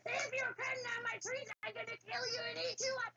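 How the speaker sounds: high, mock-menacing troll threat; roaring troll. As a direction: ham up a screaming troll. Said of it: "screaming and roaring".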